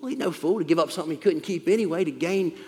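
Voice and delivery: ordinary delivery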